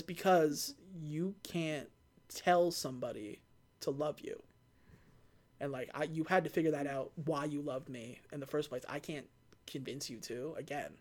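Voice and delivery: soft tender reply. Retold or imitated. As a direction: speak it gently to his wife. Dom answers Letty tenderly.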